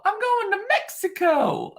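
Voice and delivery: very high, slow playful singsong; gleeful squeal. Pretend. Gloating to viewers about the Mexico trip, gleeful squeal. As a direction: squeal it out slowly in a silly high voice.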